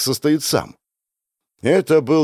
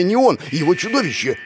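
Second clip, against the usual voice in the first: ordinary delivery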